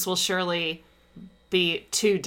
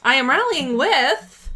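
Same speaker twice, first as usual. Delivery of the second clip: slower, louder, higher triumphant boast; triumphant drawn-out; 6 semitones higher, 7 dB louder than usual. Acted. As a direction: stretch it out like a victory cry. Stretched, louder repeat.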